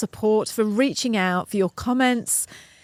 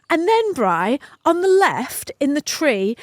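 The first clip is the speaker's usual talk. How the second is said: ordinary talk, nothing marked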